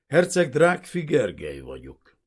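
dignified self-introduction, firmly projected; loud, high start, composed fall; measured, formal. A narrator's reading. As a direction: introduce yourself with stiff formality. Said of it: "úgy mondta kimérten".

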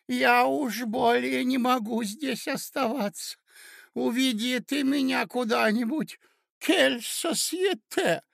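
very high, slow lament; distressed old prince. Acted. Prince begging his nephew to take him away, distressed. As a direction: beg to be led away, piping.

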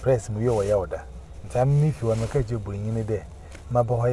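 ordinary talk, nothing marked

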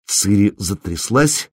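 plain reading voice

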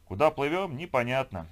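ordinary delivery, nothing marked

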